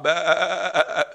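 wavering imitated bleat; stuttering "baa" of a lost sheep. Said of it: "If a sheep strays off".

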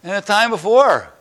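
high, loud complaining whine; a worn-out missionary complaining to God; exasperated protest. Retold or imitated. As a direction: protest to God that it's the same lesson. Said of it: "I go".

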